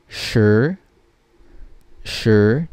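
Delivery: Shi as sh with a dark short vowel, rising; second tone. Shown as a demonstration. Teacher reading number ten, Shi in rising second tone. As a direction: slide Shi upward, no ee. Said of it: "and then second tone".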